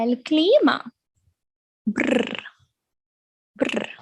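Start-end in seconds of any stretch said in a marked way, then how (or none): 1.8-3.9 breathy shivering brr; soft shiver brr. Acted. Teacher mock shivering for the cold card. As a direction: shiver like you're freezing.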